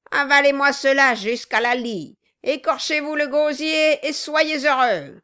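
bullying command. A narrator's reading. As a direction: drive him to swallow it. Dwarf forces drink on victim.

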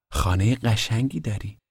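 curious kid asking, lightly played; lightly voiced boy. Narrator voices the boys' dialogue. (لحن پسربچهٔ کنجکاو، ملایم)

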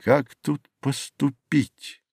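soft, slow thinking aloud; half-voice, musing. A narrator's reading. Youth puzzles over the apples to himself, musing in a half-voice. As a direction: ponder it under your breath, slowly. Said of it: "произнёс он вполголоса, как бы рассуждая сам с собою".

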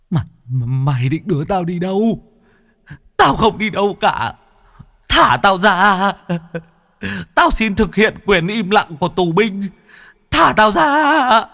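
high, loud pleading screech; panicking ghost. Acted. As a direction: scream to be released. (vong hoảng loạn gào thét)

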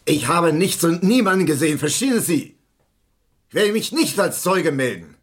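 loud indignant protest; angry refusal. Acted. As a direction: snap back that he saw nothing. Witness refuses, flaring up.

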